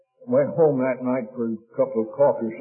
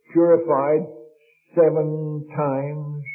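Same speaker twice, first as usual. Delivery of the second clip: slow emphasis; much slower than usual. Acted. Repeating verse for emphasis.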